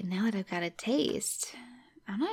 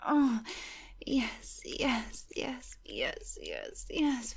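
moaning yes; 4 semitones higher, much slower than usual. Acted. Building passion in the scene.

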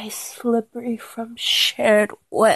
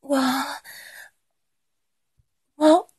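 building breathless excitement; 4 semitones higher, 5 dB louder than usual. Acted. Build to climax.

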